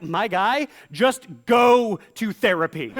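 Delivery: fed-up friend urging therapy; exasperated my guy. Acted. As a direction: beg him to get help, half laughing. Exasperated retort.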